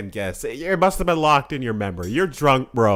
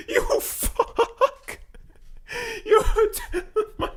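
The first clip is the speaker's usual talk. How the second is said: much higher, slow, through tears of laughter; crying with laughter; high strangled laughter; 6 dB quieter, much slower than usual. Real, not acted.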